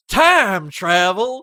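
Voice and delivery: loud, high, drawn-out mock disbelief; hammed-up senator, stretch it out loudly; mock senator outburst. Senator stands up to the camera, hammed-up loud outburst.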